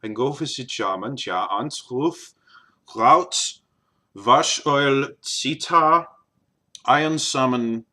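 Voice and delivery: put-on German accent. Shown as a demonstration. Vlogger attempting an accent not used in a long time, German accent. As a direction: try the word list in a German accent. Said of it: "I can do that in German accent".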